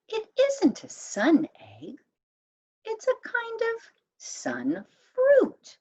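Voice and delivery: high, chirpy bird voice; a little bird explaining brightly; lightly voiced high birdie. A narrator's reading.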